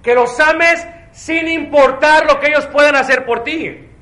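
loud, high, forceful preaching; żarliwe kazanie. Real, not acted. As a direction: drive each phrase home to the congregation.

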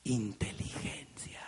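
low, slow whisper of the punchline; whispered reveal. Real, not acted. Speaker revealing the answer to the audience, whispered. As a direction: whisper it like a secret. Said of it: "Se llama".

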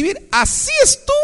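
squeaky high demo of near-quitting; frightened squeak; shaky high voice. Retold or imitated. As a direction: pitch way up as if about to give up. Preacher shows Elijah nearly quitting.

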